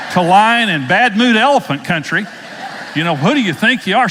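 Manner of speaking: half-laughing, fake outrage at the guide; laughing mock rant. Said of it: "I thought".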